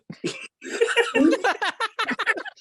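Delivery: high far laugh breaking out; laughing hard at the joke; high burst of laughter. Real, not acted. Big laugh.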